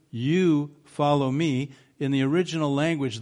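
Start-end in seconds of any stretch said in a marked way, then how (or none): 0.1-1.7 "You" drawn out and set apart, louder; slow, weighty emphasis on "You"; stress on YOU. Retold or imitated. Jesus rebuking Peter for looking at another disciple, stress on YOU. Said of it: "there is emphatic".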